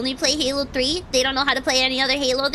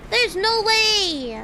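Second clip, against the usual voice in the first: outraged disbelief; 6 semitones higher than usual. Real, not acted. Gamer disputing missed headshots, outraged disbelief. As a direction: wail it like you were robbed. Protesting a death in game.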